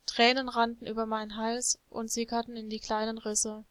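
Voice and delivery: usual speaking voice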